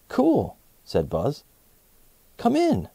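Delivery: put-on high, cheerful kid voice; excited boy. Buzz invites the cousin in, high and cheerful. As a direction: welcome the fly like an excited boy.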